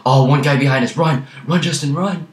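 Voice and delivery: high, loud panicked yelling; yelling in panic while fleeing; being chased. Real, not acted. Enemy right behind them, fleeing.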